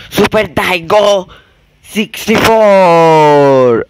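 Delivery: very slow, loud, drawn-out high announcement; announcer voice. Pretend. Gamer announcing the level name like a hammed-up announcer. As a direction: stretch it out like a game-show announcer.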